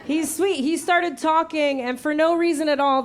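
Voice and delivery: usual speaking voice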